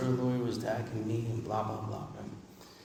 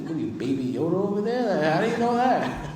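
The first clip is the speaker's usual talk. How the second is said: mock incredulity; 6 semitones higher, 3 dB louder than usual. Incredulous retort to sponsor.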